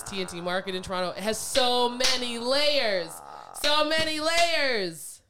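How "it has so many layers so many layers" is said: slow emphatic. Shown as a demonstration. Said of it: "emphatic drawn out".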